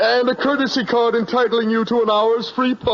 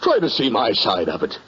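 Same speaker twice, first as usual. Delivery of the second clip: pleading; 12 semitones lower than usual. Acted. Manager begs customer to understand.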